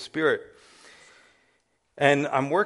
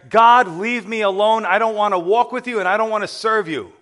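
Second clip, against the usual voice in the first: put-on drunken scream at God; 8 semitones higher, 8 dB louder than usual. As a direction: scream it up at the sky, pushing God away. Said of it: "I'm screaming in the air".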